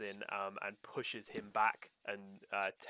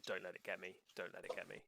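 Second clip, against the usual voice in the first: much softer, drained repeating; a worn-out loop after screaming; exhausted murmur; 3 semitones lower, 10 dB quieter, faster than usual. Acted. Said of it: "over and over and over".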